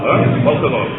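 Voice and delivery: ordinary delivery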